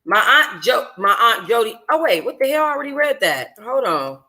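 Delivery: ordinary delivery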